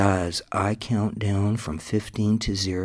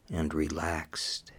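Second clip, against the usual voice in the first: whisper; 5 dB quieter than usual. Acted. Whispered hypnosis cue.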